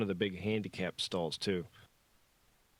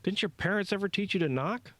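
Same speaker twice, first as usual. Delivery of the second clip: indignant; 12 semitones higher, 3 dB louder than usual. A narrator's reading. Startled Josh scolds Bishop.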